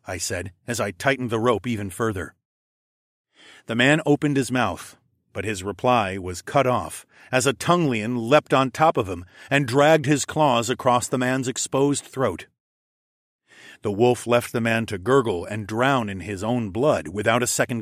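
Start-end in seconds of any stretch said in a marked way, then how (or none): none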